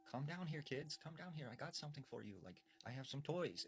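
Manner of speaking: a hint of a witch coaxing kids out of hiding; luring old woman. Old woman in the house coaxing hidden kids down, lightly voiced sweet lure. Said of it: "this woman was like".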